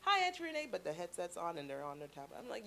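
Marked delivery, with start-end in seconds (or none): 0.0-0.7 put-on high-pitched kid voice; imitated nephew greeting. Nephew greeting his auntie on FaceTime, high kid voice. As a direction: mimic a child's hello on a call. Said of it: "They're like".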